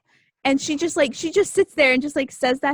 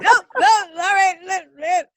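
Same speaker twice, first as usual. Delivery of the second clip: higher and louder, caught off guard; flustered surprise; 8 semitones higher, 6 dB louder than usual. Retold or imitated. Substitute teacher flustered by kids snitching, high surprise. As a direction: sputter like you've been ambushed. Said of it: "And I'm like".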